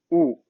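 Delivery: U as a rounded "oo"; vowel U demonstrated. Shown as a demonstration. Teacher finishing the vowel list, U sounded out. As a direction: sound out U on its own. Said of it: "nós temos o".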